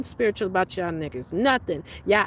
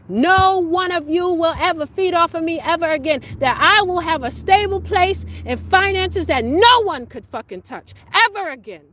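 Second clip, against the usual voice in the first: real loud, higher declaration; defiant vow; 7 semitones higher, 5 dB louder than usual. Real, not acted. Vowing to everyone who used her, loud and defiant. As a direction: shout it as an oath.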